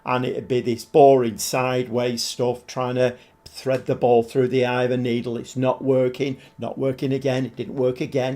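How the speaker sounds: usual speaking voice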